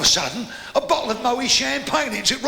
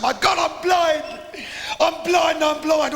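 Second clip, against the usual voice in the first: panicked cry; 6 semitones higher, slower than usual. Acted. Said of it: "She's laying on the road saying".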